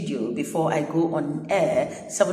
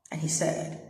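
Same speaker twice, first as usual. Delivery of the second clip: ordinary talk, nothing marked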